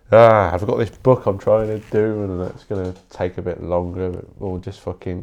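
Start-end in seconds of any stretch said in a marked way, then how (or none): none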